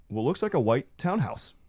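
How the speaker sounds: normal talking voice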